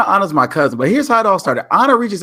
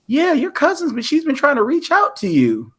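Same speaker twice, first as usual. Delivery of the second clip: high-pitched imitation of a relative; high voice passing on news; another cousin; 12 semitones higher than usual. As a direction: quote the cousin in a lifted, put-on voice. Voicing another cousin in a story.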